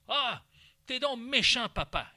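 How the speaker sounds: plus aigu et plus fort, en reproche d'enfant; enfant fâché. Acted. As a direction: jouer l'enfant qui accuse son papa. Enfant joué qui proteste. (enfant joué, voix aiguë de reproche)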